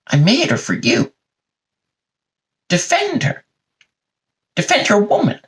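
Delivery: high, strained mock exasperation; appalled scolding. God telling off Adam, high and appalled. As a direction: plead in high disbelief, with long pauses.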